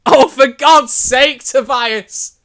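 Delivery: loud, high, drawn-out outburst; exasperated. Real, not acted. Reader complaining to writer Tobias, loudly exasperated. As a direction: groan it out loudly, laughing exasperation.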